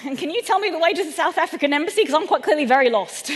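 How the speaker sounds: loud, high acted builder voice; builder's shout. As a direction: call out briskly like the shouting builder. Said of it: "this builder shouted at me".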